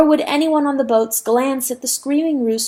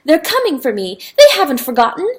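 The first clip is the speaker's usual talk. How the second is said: much higher, loud, thrilled; excited cries; 11 semitones higher, 6 dB louder than usual. Acted. Rooster seeing rescue boats, high thrilled yells. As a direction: yell with joy.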